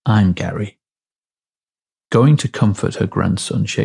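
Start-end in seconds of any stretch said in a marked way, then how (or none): 0.1-0.8 deadpan. A narrator's reading. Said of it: "Completely deadpan".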